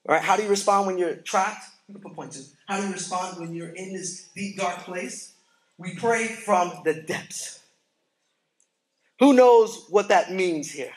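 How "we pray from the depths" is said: slow emphasis. Shown as a demonstration. Key point stated slowly.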